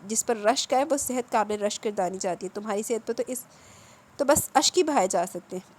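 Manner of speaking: ordinary delivery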